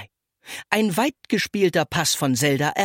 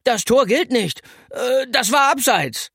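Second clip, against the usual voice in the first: high, loud objection with a flustered stammer; a sore loser grasping for a reason; protesting; 9 semitones higher, 4 dB louder than usual. A narrator's reading. Benny to the girls after their goal, protesting. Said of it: "Benny protestierte".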